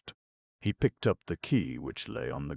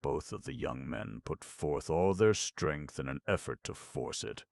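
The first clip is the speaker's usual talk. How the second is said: usual speaking voice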